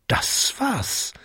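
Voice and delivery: high, breath-filled half whisper; hushed realisation. A narrator's reading. Jakob realises he needs a miracle, hushed excitement. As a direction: seize the idea in a hush.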